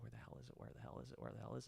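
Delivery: hushed, anxious muttering, retold; under-breath panic. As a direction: mutter it to yourself as you search. Retold panicked search for truck.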